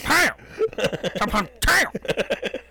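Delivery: high yelled comic-book punches; cartoon punch sounds. Friends joking about hero brawl, yelled punch noises.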